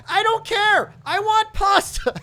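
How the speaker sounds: high, loud and slow, hammed-up demanding wife; wife's outburst. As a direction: shout it like a dramatic wife craving pasta. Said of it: "She's like".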